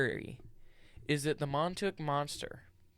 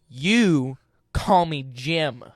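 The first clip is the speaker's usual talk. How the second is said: emphatic; 3 semitones higher, 12 dB louder, much slower than usual. Real, not acted. Insisting on his name.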